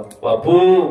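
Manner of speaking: loud, choked cry of "Bapu"; a father's desperate call to his son-in-law; pleading call. Retold or imitated.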